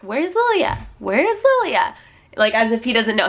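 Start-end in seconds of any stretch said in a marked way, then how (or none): 0.0-1.9 high singsong question with swooping pitch; imitated toddler's high query; imitated toddler asking. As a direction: ask like a two-year-old looking for someone. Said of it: "he always has to ask".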